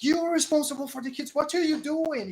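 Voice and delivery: fast, high, loud scolding; angry berating. Acted. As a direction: fire it off in outrage. Acted man berating another mid-fight.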